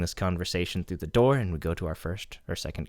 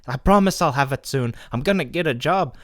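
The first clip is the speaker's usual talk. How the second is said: higher, louder mock plea; pleading tenant; 7 semitones higher, 6 dB louder than usual. Tenant promising the landlady rent, mock pleading. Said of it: "He's like".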